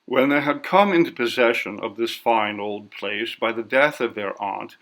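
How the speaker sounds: ordinary delivery, nothing marked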